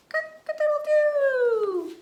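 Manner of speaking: loud, high rooster cock-a-doodle-doo; rooster crow. Retold or imitated. Teacher imitates rooster for kids.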